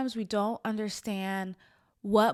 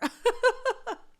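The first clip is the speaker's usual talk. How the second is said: teary laugh; 6 dB louder than usual. Real, not acted. Laughing through tears on camera.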